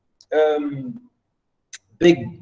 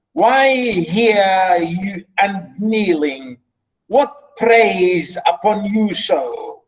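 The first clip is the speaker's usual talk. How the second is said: raised pitch on each call, then slow questioning; dramatic, deliberate reading; lightly voiced king; 2 semitones higher, slower than usual. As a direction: urge the old priest to speak, drawn out. Reads king addressing the priest.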